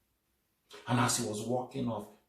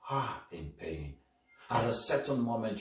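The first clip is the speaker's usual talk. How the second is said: usual speaking voice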